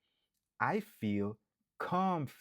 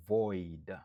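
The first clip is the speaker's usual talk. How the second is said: void said alone and firm; stressed syllable pulled out; 3 semitones lower than usual. Shown as a demonstration. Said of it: "Se la ke w ap aksantye".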